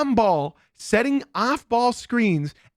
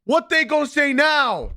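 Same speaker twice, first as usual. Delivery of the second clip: gloating fan; 7 semitones higher, 4 dB louder, slower than usual. Retold or imitated. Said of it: "my one Magic fan in my Discord ... saying".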